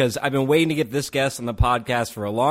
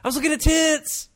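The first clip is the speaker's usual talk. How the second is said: much higher, hammed-up denial; mock defensive teen; 13 semitones higher than usual. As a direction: play the caught kid who bluffs. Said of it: "he's like".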